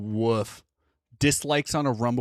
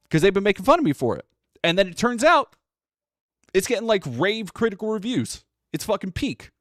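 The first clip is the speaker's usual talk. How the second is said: higher, louder, fast gleeful triumph; excited vindication; 7 semitones higher, 4 dB louder than usual. Real, not acted. Telling chat the movie was peak, excited and higher. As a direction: gloat like you were right all along.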